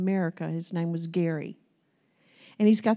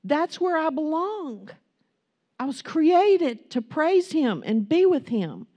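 fervent preaching; 6 semitones higher than usual. Acted. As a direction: lift the crowd toward heaven. Preaching at full force.

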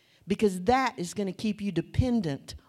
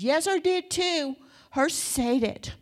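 imitating a stubborn three-year-old, higher and louder; defiant toddler; 8 semitones higher, 5 dB louder than usual. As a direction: mimic the little girl digging in her heels, high pitched. Three-year-old insisting to grandmother.

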